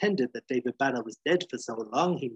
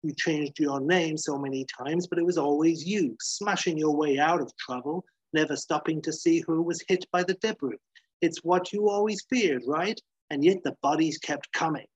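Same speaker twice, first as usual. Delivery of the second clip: higher-pitched, needling tirade; goading accusation; 4 semitones higher than usual. Acted. McGee goading Banner about the dead, relentless. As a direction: keep pressing him, phrase after phrase.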